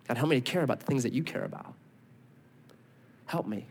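low, hushed plea to God; earnest whispered-down prayer; soft prayer. Real, not acted. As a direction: pray it quietly, almost breaking off. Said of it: "That's my prayer for myself".

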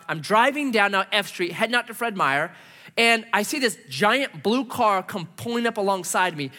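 ordinary talk

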